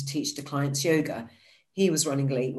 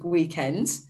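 ordinary talk

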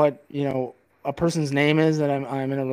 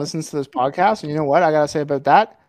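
ordinary talk

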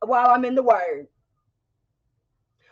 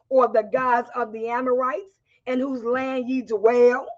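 ordinary talk, nothing marked

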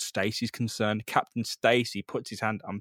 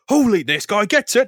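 excited awe; 10 semitones higher, 10 dB louder than usual. Acted. Peter's excited inner reaction.

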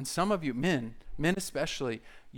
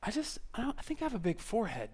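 put-on high, hushed girlish voice; insecure girl; 5 semitones higher, 8 dB quieter than usual. Girl confiding in her friends about her looks, insecure. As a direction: fish softly for reassurance, pitched up. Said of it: "if you girls were hanging out with your friends".

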